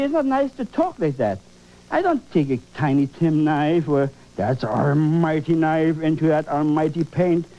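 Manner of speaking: normal talking voice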